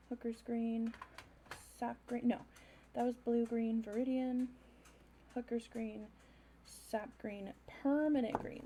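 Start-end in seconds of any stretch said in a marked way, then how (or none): none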